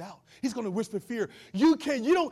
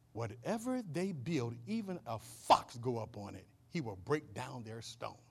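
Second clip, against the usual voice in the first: mocking; 8 semitones lower, 8 dB quieter than usual. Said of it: "that's some serious mocking right there".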